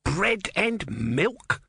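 high, slow, outraged mock shout. A narrator's reading. Om outraged at the barman's offer, shouting. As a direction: spit back the offer in disgust. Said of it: "shouted Om".

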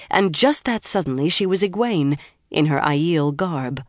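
plain reading voice

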